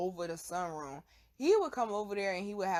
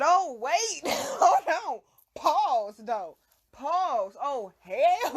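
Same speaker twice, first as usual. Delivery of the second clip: high and loud, laughing through it; freaking out as it sinks in; sudden high, loud alarm; shocked panic; 5 semitones higher, 6 dB louder, much slower than usual. Retold or imitated. Realizing it's angel dust.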